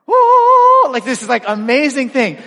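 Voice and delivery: a very high, loud burst of wonder; amazed oh. Retold or imitated. Man breathing freely after the inhaler, very high, loud oh. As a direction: relief hits all at once.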